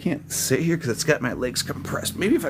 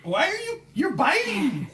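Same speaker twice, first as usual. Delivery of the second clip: much higher startled squeal; surprised yelp. Real, not acted. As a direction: yelp as he bites. Bitten by the dog.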